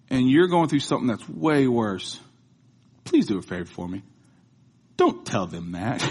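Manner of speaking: ordinary talk, nothing marked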